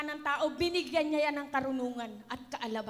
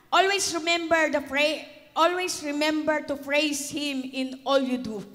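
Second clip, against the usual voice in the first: loud preaching of the verse; proclaimed verse; 8 dB louder than usual. Real, not acted. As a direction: proclaim it to the church. Proclaiming scripture to congregation. (malakas na pagpapahayag ng talata)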